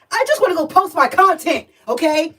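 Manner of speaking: high, put-upon whine; mock-pleading complaint; exasperated plea. Acting out her own frustration waiting.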